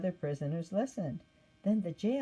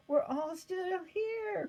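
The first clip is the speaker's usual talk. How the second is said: much higher, slow call; crying out; 13 semitones higher, slower than usual. Acted. Paul calling to the panicked jailer, high and slow. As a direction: call out slowly to reassure. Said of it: "cried Paul".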